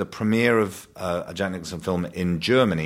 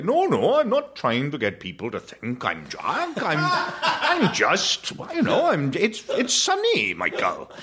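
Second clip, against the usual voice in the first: high, swooping posh Welsh protest; dad playing Jack; 8 semitones higher, slower than usual. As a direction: drawl a hammed-up denial as the posh Welsh dad. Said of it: "and he'd say".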